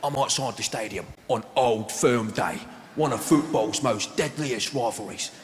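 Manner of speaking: mock Cockney documentary presenter, halting and dramatic; put-on London hard man, slowing at the end; Danny Dyer. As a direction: present to camera in short, weighty phrases. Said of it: "he's talking to the camera".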